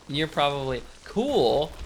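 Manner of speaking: ordinary delivery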